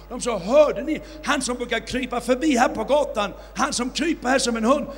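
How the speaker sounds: loud, rising preacher's storytelling; fiery preaching. Acted. Preacher recounting the miracle to his audience, fiery and loud. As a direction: drive the miracle story home to the crowd.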